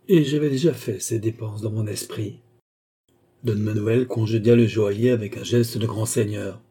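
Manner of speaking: usual speaking voice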